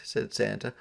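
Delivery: ordinary delivery, nothing marked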